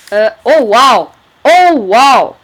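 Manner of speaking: loud, awestruck wow; amazed. Real, not acted. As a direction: belt it out in amazement. Amazed at a villager's trade offer. (émerveillement bruyant)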